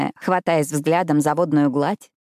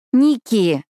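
higher, reproachful name call; displeased; 5 semitones higher, 4 dB louder than usual. A narrator's reading. Ashley scolding her friend from the bathroom, displeased. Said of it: "недовольно начала Эшли".

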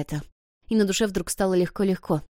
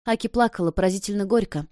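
usual speaking voice

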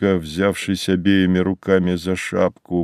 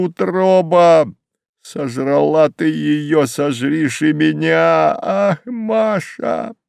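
slow, high-pitched lament, climbing higher; anguished wail; 7 semitones higher, slower than usual. Acted. Widower crying to the earth for his wife, wailing. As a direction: wail it out, stretching the words.

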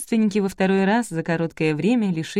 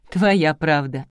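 plain reading voice

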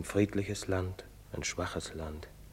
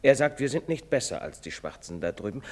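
usual speaking voice

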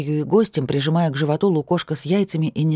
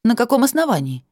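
indignant; 8 semitones higher, 3 dB louder than usual. A narrator's reading. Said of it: "возмущённо".

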